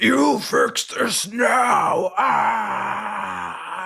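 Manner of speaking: imitating a raging caller's scream; retold furious scream. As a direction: scream it at the phone like a caller gone wild. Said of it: "And he screamed".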